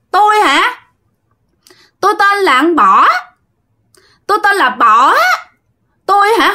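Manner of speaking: put-on high, pleading voice of a drowned girl's spirit; ghost girl voice. Spirit answering the medium, in a high girl's voice. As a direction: speak high like the ghost girl possessing him.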